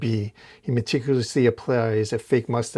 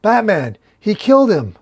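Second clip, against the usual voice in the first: much higher, louder accusing shout; crowd yelling; 10 semitones higher, 7 dB louder than usual. Retold or imitated. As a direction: yell the accusation up at him. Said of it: "as they start yelling".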